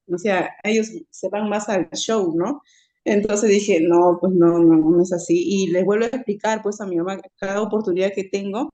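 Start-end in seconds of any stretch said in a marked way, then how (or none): 3.8-5.3 loud, insistent denial; firm loud no. Retold or imitated. Speaker retelling her objection to the pastor, loud insistent denial. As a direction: push back hard and loud. Firmly contradicting the pastor's teaching.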